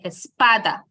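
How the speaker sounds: espada correctly stressed on middle PA. Shown as a demonstration. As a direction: bring the weight back to PA in espada. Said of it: "we place a stress on the pa".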